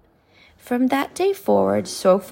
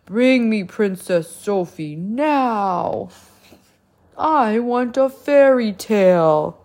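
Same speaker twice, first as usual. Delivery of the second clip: slow, louder booming giant; 6 dB louder than usual. Acted. The giant to the king and queen, booming demand. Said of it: "the giant roared".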